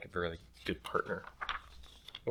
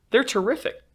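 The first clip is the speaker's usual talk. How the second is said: delighted teacher; 6 dB louder than usual. Acted. Teacher praises work.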